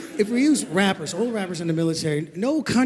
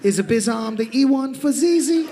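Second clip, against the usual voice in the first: rapper slang voice; 4 semitones higher than usual. Pretend. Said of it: "in his hip-hop talk".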